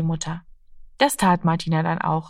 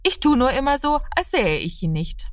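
high start, settling to normal pitch; defensive; 7 semitones higher than usual. A narrator's reading. Martina explains herself to mother, defensive, starting high. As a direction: justify yourself, starting high. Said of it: "verteidigte sich Martina".

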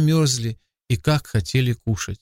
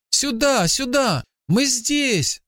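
understated shout in a much higher voice; boy calling out; 11 semitones higher, much slower than usual. A narrator's reading. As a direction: call out high like a boy hailing a boat. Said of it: "закричал мальчик".

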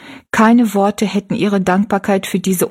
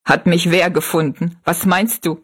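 ordinary delivery, nothing marked

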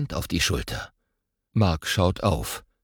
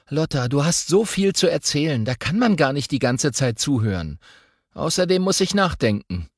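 a hint of a thoughtful boy, somewhat higher; lightly voiced boy; 7 semitones higher than usual. Boy telling his twin sister he must think, lightly voiced. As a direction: calmly defend yourself to a chatty sister.

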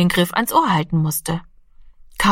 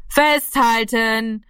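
long, drawn-out higher call; 4 semitones higher, much slower than usual. A narrator's reading. Gaeto calls to Rami at the window, calling out. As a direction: call it slowly, each syllable stretched. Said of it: "rief Gaeto".

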